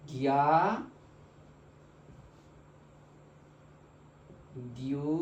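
নিয়া, নিউ, নিও each said alone after a long pause; slow syllable demo. Shown as a demonstration. Teacher modelling the new syllables, slow and deliberate. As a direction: model every syllable for students to repeat. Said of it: "pronunciation কি হবে?".

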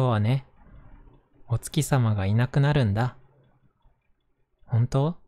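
gentle storybook narration voice; reading to children. Acted. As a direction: read it aloud to little kids. Reads a picture book aloud.

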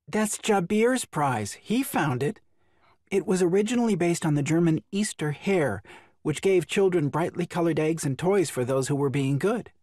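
high, lightly voiced woman; a gentle, playful young woman; female character voice. A narrator's reading. Ariel voiced by narrator.

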